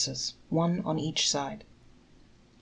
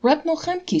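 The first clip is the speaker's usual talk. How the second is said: astonished stammer; 11 semitones higher, 6 dB louder than usual. A narrator's reading. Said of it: "stammered the innkeeper".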